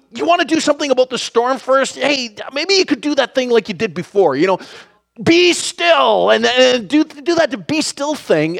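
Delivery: high, fast, nervous backpedalling babble; nervous Peter. Retold or imitated.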